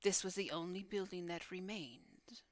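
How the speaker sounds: hushed, half-whispered guardian voice with long pauses; solemn guardian. Acted. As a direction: speak as a solemn keeper of dark stories. Guardian recounts the building's fall.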